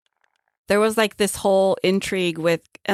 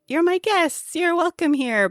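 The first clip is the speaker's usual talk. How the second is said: high grand host voice; 11 semitones higher than usual. Retold or imitated.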